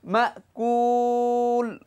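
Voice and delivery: মাকুল with the উ drawn out very long; long madd stop. Shown as a demonstration. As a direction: stretch মাকুল slowly, falling at the end. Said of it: "তিন আলিফ টেনে পড়তে হয়".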